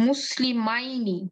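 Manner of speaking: মুসলিমাইনি drawn out with each syllable separate; slow model reading. Shown as a demonstration. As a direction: read মুসলিমাইনি slowly for learners. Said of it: "এরপরে পড়ি".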